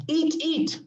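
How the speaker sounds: acted eat eat. Shown as a demonstration. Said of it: "first eat is a command verb".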